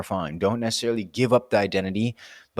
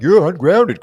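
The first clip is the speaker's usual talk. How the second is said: higher and louder mock scolding; mock accusation; 7 semitones higher, 9 dB louder, slower than usual. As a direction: imitate people calling you out, hammed up. Mimics people scolding her.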